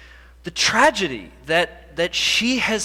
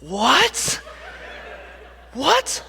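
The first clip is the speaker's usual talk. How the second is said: higher, rising stunned mock shout; girl stunned that he is the husband; stunned double take; 9 semitones higher than usual. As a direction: act out the girl's jaw-dropping double take. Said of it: "She turns to me real slow".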